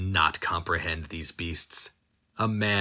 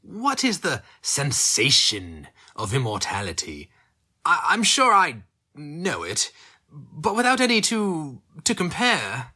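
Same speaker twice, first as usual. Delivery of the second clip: higher, airy and amused; musing villain; 6 semitones higher than usual. Acted. Captor musing on immortality, higher and amused. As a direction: toy with the thought, light and stammering.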